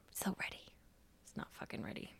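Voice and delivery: hushed, voiceless mock confidence; whispered aside. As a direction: whisper it like a private confession. Retold inner doubt before ceremony.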